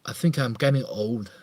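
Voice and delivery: normal talking voice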